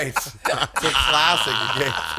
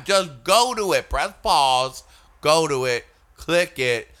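ordinary talk

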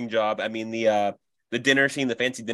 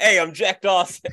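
put-on Boston accent; 7 semitones higher, 4 dB louder, slower than usual. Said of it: "I can't really do a Boston accent".

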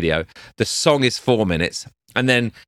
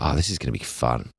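calm; 6 semitones lower, 6 dB quieter than usual. Retold or imitated. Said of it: "she was just really calm".

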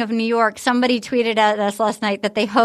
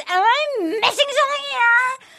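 higher, hammed-up outraged rant; mock Victoria rant; 9 semitones higher than usual. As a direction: play the offended contestant storming off, pitched up. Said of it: "And she goes".

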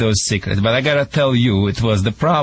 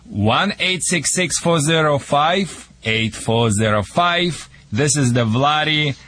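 higher, slow announcer pitch with a heavy put-on Russian accent; hammed-up radio ad; 4 semitones higher, slower than usual. Vladdy plugging his show to America, infomercial announcer voice. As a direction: sell the dog wizard like a late-night infomercial.

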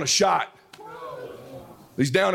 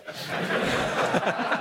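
breathless laugh; 6 semitones higher, 5 dB quieter than usual. Real, not acted.

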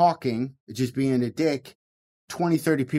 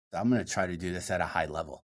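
inner thought; 6 semitones lower, 7 dB quieter, faster than usual. Retold or imitated. Voicing his private thought mid-bit.

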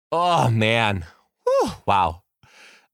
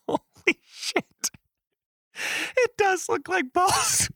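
high, slow, breathy gasp of disbelief; shocked high gasp; stunned whisper-shout; slower than usual. Retold or imitated. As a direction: whisper it in shock, then squeal. Teacher reacting to drawing.

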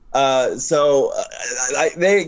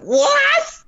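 shocked shout. Retold or imitated. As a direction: shout it in disbelief. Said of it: "I'm like".

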